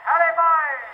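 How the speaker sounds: put-on high shouted call to the boys. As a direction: yell it out across the train. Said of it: "we shouted".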